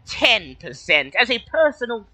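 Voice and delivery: high, slow, formal little kobold. Acted. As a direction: speak as a polite high-pitched kobold. GM voices kobold.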